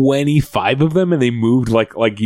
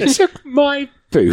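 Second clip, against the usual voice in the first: put-on British accent; slower than usual. Echoing Jane's line.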